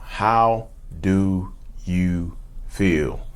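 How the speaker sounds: extremely slow and low; slow, word-by-word question. Real, not acted. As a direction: let each word sink in.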